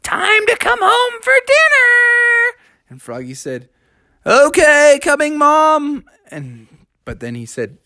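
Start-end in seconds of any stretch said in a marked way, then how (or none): 0.0-2.5 high, drawn-out singsong holler; mom's long-distance dinner call. Acted. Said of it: "calling out over the swamplands". 4.2-6.0 high, loud, stretched reply; singsong answering call; calling back. Acted. Frog calls back to mother.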